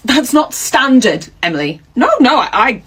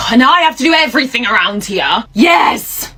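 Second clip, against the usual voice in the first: exasperated shout. Acted. Karen exasperated shouting back.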